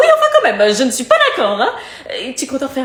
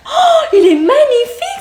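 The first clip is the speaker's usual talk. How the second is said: gushing; 8 semitones higher, 4 dB louder, slower than usual. Acted. Mother gushes over the tattoo.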